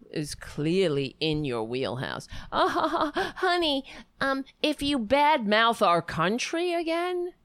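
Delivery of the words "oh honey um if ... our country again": high, sarcastic mimicry of the woman; mock her threat in a raised pitch; hammed-up, high-pitched scolding; mocking imitation.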